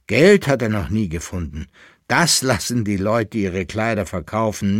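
usual speaking voice

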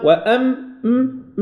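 usual speaking voice

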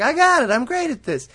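high, loud, cocky burst; mock eager confidence. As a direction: imitate a keen student boasting. Katara boasts in training, retold.

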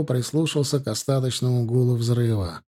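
ordinary delivery

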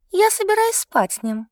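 high, unruffled announcement; calmly shocking. A narrator's reading. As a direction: drop the bombshell calmly. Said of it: "спокойно огорошила Лиза сестру". (спокойно, высоким голосом)

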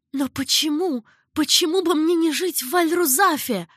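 high, loud, desperate questions; anguished protest. A narrator's reading. Zeinab protests to the caliph, high and loud. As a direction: she can't believe it, voice rising.

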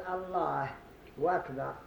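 الله with the ha made silent, then وكبر; waw for the damma. Shown as a demonstration. Teacher mimics the error of turning the damma into waw. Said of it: "يقلب الضمة واوا ويسكن الهاء".

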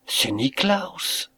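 slow, hushed astonishment; understated, stretched-out exclamation of recognition; moved recognition. A narrator's reading. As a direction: recognise him slowly, with a touch of wonder. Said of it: "non moins ému".

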